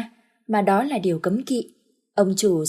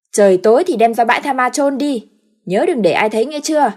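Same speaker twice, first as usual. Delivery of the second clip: commanding order; 4 semitones higher, 8 dB louder than usual. Acted. Master orders servant.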